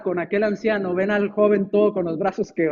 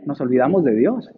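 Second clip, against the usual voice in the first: ordinary talk, nothing marked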